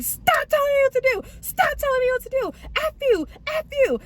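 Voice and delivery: imitated high-pitched screaming; retold screaming. Drunk boyfriend screaming at his girlfriend in the car, high-pitched. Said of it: "he just kept screaming".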